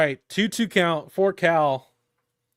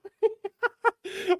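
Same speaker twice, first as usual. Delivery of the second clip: breathless giggle, much higher; high giddy laugh; 3 dB quieter than usual. Real, not acted. Fan giggling after screaming the home run call. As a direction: giggle at your own outburst.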